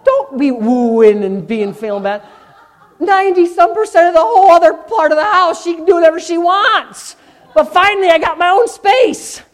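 high, loud mock rant; facetious shouting. Preacher joking to congregation about his own space, shouting in mock defiance. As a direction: playfully holler it, stretched for comic effect. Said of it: "I'm being facetious and sarcastic".

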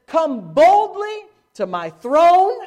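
slow, loud, high declaration; bold proclamation. Acted. Preacher booming God's call to the throne, slow and loud. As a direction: proclaim it slowly and boldly. Quoting God boldly.

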